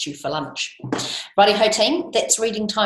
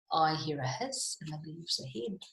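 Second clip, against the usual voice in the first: hushed, suspenseful monster line; much softer storybook suspense; wary listening; 9 dB quieter than usual. Acted. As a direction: drop soft, as if listening out. Gruffalo listening in the wood.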